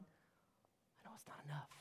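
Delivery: slow, breathy whisper; whispered admission. Acted. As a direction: let the voice collapse to a whisper. Peter's admission, collapsing voice.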